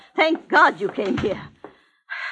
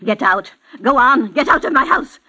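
loud, high, shaking with rage; indignant shouting; furious outburst; 6 semitones higher, 7 dB louder than usual. Acted. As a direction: order them all out. Old woman throws everyone out.

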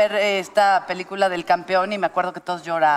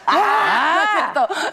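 laughing retraction; 8 semitones higher, much slower than usual. Real, not acted. Retracts joke title.

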